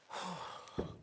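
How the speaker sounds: long weary sigh; tired sigh. Real, not acted. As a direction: let out a tired breath. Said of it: "Tired now".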